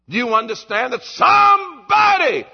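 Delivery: loud rising challenge; preaching shout. Real, not acted. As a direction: hammer the question home. Preaching climax.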